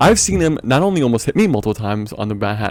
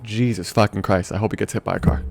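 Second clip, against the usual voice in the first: muttered aside; 4 semitones lower, 7 dB quieter than usual. Retold or imitated. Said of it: "I was like".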